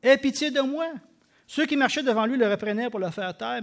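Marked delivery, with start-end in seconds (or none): 0.0-0.9 loud pleading call, slow on fils de David; crying out. A narrator's reading. Blind Bartimaeus begging Jesus, a loud plea. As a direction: call across the crowd for mercy. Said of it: "Et il cria".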